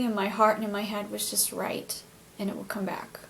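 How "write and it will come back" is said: breathy unvoiced whisper, very soft; whispered inner voice. Recalling the voice she heard while writing, soft whisper. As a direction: whisper it like a voice in your heart.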